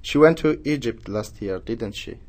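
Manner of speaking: didn't she dropping at the end; conversational, falling tune; falling question tag. Shown as a demonstration. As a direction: let the tag fall, just confirming. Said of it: "that's down".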